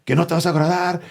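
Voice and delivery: high-pitched imitation of an outraged mother; mock-scandalized Mexican mom; mom losing it. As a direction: act out mom's disbelieving outburst in Spanish. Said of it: "my mom started losing her mind".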